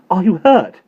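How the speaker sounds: loud, high worried mother; anxious mother. Acted. Mother grabs her son at the gate, loud and high. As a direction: grab the boy and demand it.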